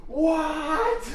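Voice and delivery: high-pitched yelp of "what"; retold yell. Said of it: "like, yelled. I was like".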